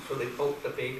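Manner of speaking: normal talking voice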